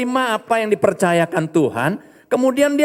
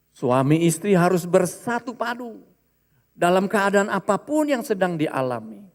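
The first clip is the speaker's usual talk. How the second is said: ordinary talk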